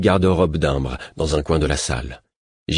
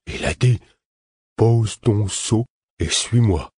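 put-on higher, slow, childlike quoted order; a timid man repeating what he was told; frightened simple man; 4 semitones higher, slower than usual. A narrator's reading. Simple man repeating the stranger's order, slow childlike voice.

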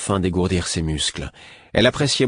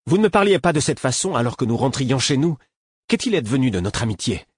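hurt anger; 6 semitones higher than usual. A narrator's reading. Prince reproaching his old friend, hurt and angry. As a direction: demand back the lost friendship, stung. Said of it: "s'exclama-t-il avec colère".

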